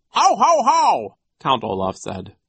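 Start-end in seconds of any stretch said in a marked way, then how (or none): 0.1-1.2 high, loud "how, how, how" laugh; fake villain laugh. A narrator's reading. Count Olaf laughs along in disguise, loud spoken laugh. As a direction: bellow out a high, mirthless haw-haw. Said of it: "abruptly stopped laughing".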